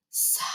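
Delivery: breathy whispered sigh. Acted. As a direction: let out a weary sigh. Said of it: "he keeps sighing".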